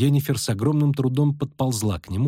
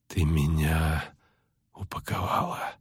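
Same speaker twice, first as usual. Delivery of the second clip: slow, low, faint and broken by a pause; dazed, labored half-voice; halting weak speech; 6 semitones lower, 4 dB quieter, slower than usual. A narrator's reading. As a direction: struggle the words out one by one. Dazed sorceress struggling to speak.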